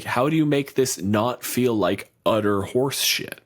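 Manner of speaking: ordinary talk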